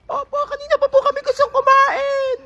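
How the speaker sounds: put-on high kid voice complaining to ma'am; high whiny student; whiny child. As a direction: complain to the teacher like a little kid. Student pleading to principal.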